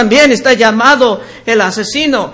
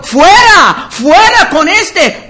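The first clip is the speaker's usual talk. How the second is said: mock crowd shout; 5 semitones higher, slower than usual. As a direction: shout it like the angry mob. (gritando como la multitud)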